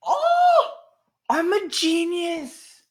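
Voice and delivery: real high, loud burst of amazement; gasp, then shriek it out in drawn-out triumph; thrilled, high-pitched freak-out. Real, not acted. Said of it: "I know I'm freaking out right now".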